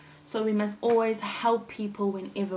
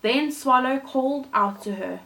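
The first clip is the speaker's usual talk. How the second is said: gentle storybook reading voice; storyteller reading a fairy tale to kids; story narration; 2 semitones higher, 4 dB louder than usual. A narrator's reading. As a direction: read it like a bedtime story for children. Reads Thumbelina story aloud.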